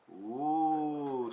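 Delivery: long, drawn-out "ooh"; contented sigh. A narrator's reading. Don Diego after the soft landing, sighing. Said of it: "sighed Don Diego".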